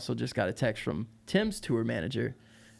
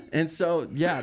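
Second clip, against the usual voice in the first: normal talking voice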